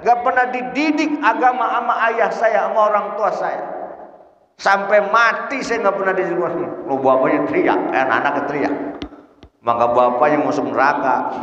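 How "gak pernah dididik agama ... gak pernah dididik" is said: high-pitched wailing complaint; crying out from hell. Acted. Child in hell blaming the father, crying out. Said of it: "anakmu di neraka teriak".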